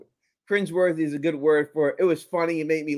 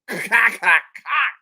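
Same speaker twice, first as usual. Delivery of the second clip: clicking mouth sound of a gun cocked; playful imitation of a gun cocking; gun cocking noise; 4 dB louder, much slower than usual. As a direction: mimic a gun being cocked three times. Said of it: "she's like".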